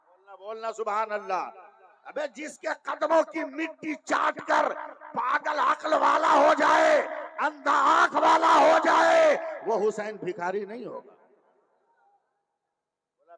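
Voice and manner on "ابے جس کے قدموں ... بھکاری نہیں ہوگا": fiery preaching. Acted. A preacher shouting Husain's greatness to the congregation, fiery. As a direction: thunder it at the crowd.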